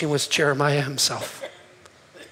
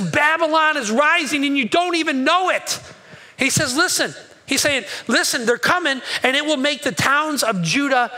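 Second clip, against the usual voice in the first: urgent prophetic warning; 6 semitones higher than usual. Acted. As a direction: sound the alarm about Babylon. Preacher voicing prophetic warning.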